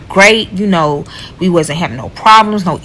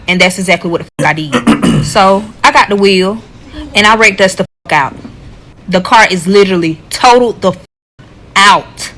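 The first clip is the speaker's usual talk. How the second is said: usual speaking voice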